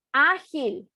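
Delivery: "Ágil" punched on the first syllable, falling after; teacher's example word, "Ágil"; stressed first syllable. Shown as a demonstration. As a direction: say "Ágil" crisply with the weight on Á. Pronounces example word to show stress.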